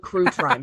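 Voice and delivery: "True Crime" with first sounds swapped, "Crew Trime"; spoonerism. Shown as a demonstration. Host announcing today's episode title, spoonerism. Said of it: "that's the title, by the way".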